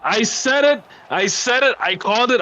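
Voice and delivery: high-pitched, triumphant I-told-you-so; excited vindication. Real, not acted. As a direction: crow that you called it. Prediction comes true during live goal.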